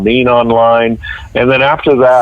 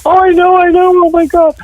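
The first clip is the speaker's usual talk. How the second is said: panicky. Retold or imitated. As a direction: squeal it like a frazzled operator who already knows. Imitating panicking 911 operator.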